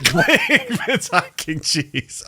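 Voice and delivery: high, slow, laughing product pitch; laughing ad slogan. Pretend. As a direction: stretch the brand name while laughing. Mock product name through laughter.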